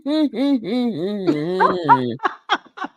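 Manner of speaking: long, drawn-out mock chuckle; imitated laugh. Mimicking a guest laughing off a complaint, long mock laugh. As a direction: mimic his laugh, overdone. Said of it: "And then he laughed about it".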